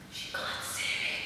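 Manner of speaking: barely-there whisper, mimicking someone in hiding; secretive mock whisper; hushed sneaking whisper. Acts out man hiding from her.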